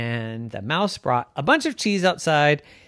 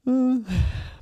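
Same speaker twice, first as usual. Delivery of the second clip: high gasp; 10 semitones higher than usual. Acted. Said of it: "Isabel went".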